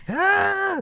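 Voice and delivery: shrill delighted squeal; a sudden high-pitched squeal. Real, not acted. Squeals at girlfriend joke.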